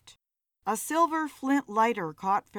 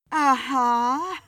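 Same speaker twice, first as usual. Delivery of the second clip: rueful laugh; 4 semitones higher, 6 dB louder than usual. A narrator's reading. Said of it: "Kelly sighed".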